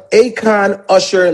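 ordinary delivery

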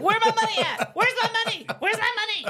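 high, loud, demanding cartoon baby voice; shrill debt collector baby hammering the question; Stewie impression. Imitating Stewie demanding money from Brian, high and loud. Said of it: "He's like".

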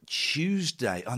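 ordinary talk, nothing marked

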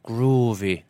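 Groovy said in a broad West of Ireland accent; Groovy with a West of Ireland lilt. Shown as a demonstration. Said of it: "really accentuates my West of Ireland accent".

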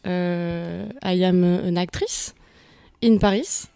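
heavily French-accented English; self-mocking bad English in a French accent. Shown as a demonstration. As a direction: speak halting English with a strong French accent. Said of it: "I have a really bad, euh, English accent".

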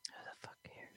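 whispered jab; whispering. Real, not acted. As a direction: whisper it under your breath. Whispered jab at her friend.

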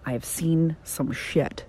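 slow whisper, voice dropped away; whispered aside. Real, not acted. Telling listeners about online business, a slow whisper. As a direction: confide it under your breath.

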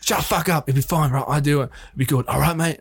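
mock Australian, cutting a protest short; Russell Crowe. Crowe silences his objecting co-star, imitated Aussie voice. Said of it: "Stop doing the voice".

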